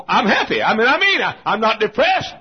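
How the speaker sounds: high, loud pulpit protest; emphatic insistence. Acted. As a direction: shout it as if nobody believes you. Loudly insists he is happy.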